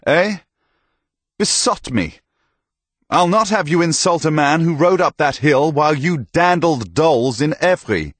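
put-on older knight defending Thomas against the young knight, high and indignant; rebuking knight. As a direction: scold the young pup hotly.

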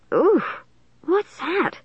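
high-pitched, grossed-out recoil; disgusted. A narrator's reading. Margaret smells skunk on the night air, disgusted. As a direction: react to a foul stink. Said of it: "Margaret twisted her features in disgust".

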